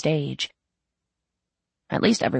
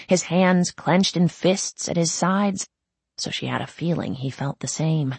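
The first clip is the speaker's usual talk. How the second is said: usual speaking voice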